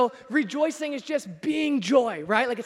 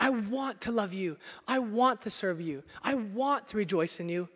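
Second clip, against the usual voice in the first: softer, lower, earnest longing; soft sincere prayer; 3 semitones lower, 6 dB quieter than usual. Pretend. Worshipper quietly telling Jesus they want to serve.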